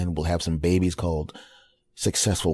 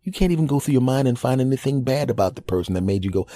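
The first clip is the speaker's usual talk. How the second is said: ordinary talk, nothing marked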